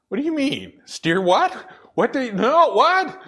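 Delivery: hammed-up shocked what; mock bewilderment. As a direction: pitch jumps way up, incredulous. Mock reaction to God's call.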